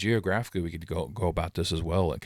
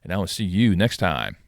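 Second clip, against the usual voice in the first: louder upbeat outro pitch; outro announcer read; 5 dB louder than usual. Acted. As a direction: sell the subscribe plug brightly. Channel outro call to action.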